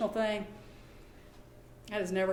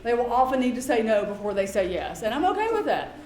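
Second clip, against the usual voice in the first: ordinary delivery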